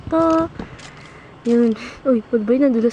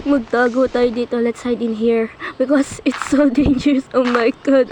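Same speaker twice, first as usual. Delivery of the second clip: ordinary talk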